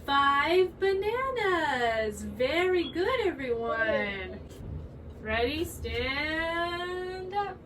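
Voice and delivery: put-on slow, sing-song voice for little kids; cheerful preschool teacher, drawn out; kids' teacher voice. Leads a young kids' class.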